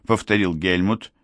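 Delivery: ordinary delivery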